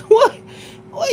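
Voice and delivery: high, incredulous laughing what; laughing disbelief. Real, not acted. Laughing at the idea of angry sex. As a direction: squeak it out in disbelief.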